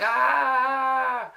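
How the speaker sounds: short high mock scream; imitated scream. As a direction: let out a funny shriek. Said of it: "literally just screaming".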